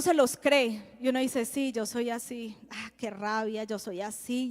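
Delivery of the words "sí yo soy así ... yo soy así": quiet, resigned self-talk, much softer; self-pitying mutter. Retold or imitated. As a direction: mutter it to yourself, beaten down. Persona frustrada que se lo cree. (murmullo resignado, mucho más suave)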